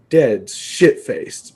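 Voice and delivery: drawn-out, dawning realization; slow realization. Retold or imitated. Son realizing his dad is drunk, slow dawning. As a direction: let it sink in very slowly.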